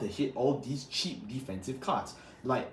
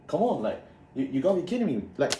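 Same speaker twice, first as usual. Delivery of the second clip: usual speaking voice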